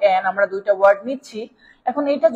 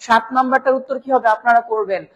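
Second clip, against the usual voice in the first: usual speaking voice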